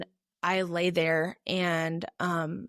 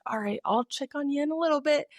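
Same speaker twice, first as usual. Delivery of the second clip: higher, light voice; easygoing captain; 6 semitones higher than usual. Retold or imitated. Captain leaving the panicking runner be, easygoing reassurance. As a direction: imitate a helper backing off kindly.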